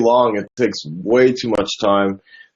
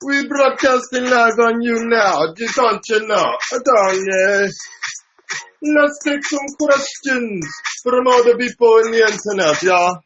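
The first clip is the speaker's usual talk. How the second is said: put-on a higher-pitched Russian accent greeting the stream; 8 semitones higher than usual. Streamer greets viewers and takes questions in a Russian accent. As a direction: put on a Russian accent to hype up the YouTube chat. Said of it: "Why am I speaking with a Russian accent now?".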